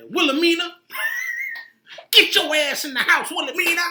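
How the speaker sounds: high, loud scolding owner; mock scolding. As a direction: scold the dog in a high yell.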